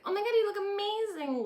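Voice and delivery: high, loud gush of praise; over-the-top delighted mother; squealing compliment. Retold or imitated. Lorelai gushing over the uniform.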